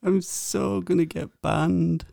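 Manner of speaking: high, soft, anxious wail; nervous dread. Real, not acted. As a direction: dread the call you're making, high-pitched. Dreading the prank phone call.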